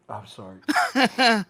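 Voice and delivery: ordinary delivery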